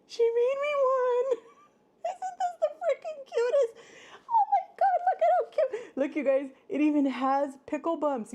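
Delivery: very high, loud squeals of joy over the gift; squealing delight. Real, not acted. Vlogger showing viewers a gift pickle, squealing with delight. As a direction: gush like it's the cutest thing ever.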